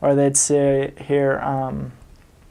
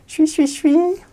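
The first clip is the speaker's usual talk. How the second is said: much higher squeaky mock of rushed English; mocking fast talk; 14 semitones higher, slower than usual. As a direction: parody gabbling too fast to follow. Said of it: "he just say".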